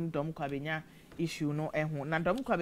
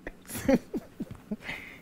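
real breathy, voiceless laugh; 9 semitones higher than usual. Real, not acted. Presenter amused by a listener's comment, laughing. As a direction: laugh mid-sentence, mostly breath.

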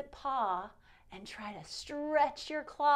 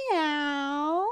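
playful meow; 3 semitones higher, 5 dB louder than usual. Pretend. Cat pose sound.

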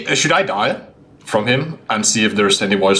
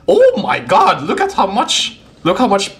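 amazed; 8 semitones higher than usual. Real, not acted. Amazed at prison credits length.

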